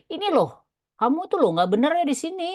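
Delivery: lightly voiced program nagging the user; voicing the program. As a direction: point out the mistake like a nagging program. Voicing program pointing out error.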